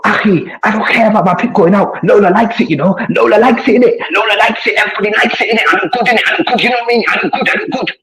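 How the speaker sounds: high, smug mock of a vain rival bragging; mocking impression. Mocking the rival who rang him, high smug voice. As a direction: ham up his rival's self-satisfied boasting. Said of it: "You rang me, you go".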